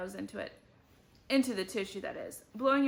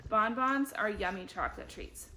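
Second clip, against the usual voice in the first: ordinary delivery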